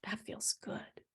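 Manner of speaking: whispered, barely voiced relief; soft whisper. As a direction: breathe it out softly. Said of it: "you get a little ping, like".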